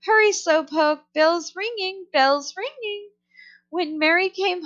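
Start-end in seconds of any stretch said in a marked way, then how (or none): 0.1-3.1 high, rising yell after her; parrot yelling. A narrator's reading. Parrot yelling after Mary as she runs, jeering. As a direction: call out like a jeering bird. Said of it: "Penelope yelled after her".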